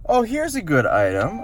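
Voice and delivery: high-pitched pretend murmur at normal volume; mock private aside. Shopper spotting a good item, mock aside to himself. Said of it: "I say quietly to myself".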